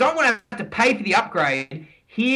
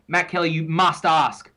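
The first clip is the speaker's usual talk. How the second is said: normal talking voice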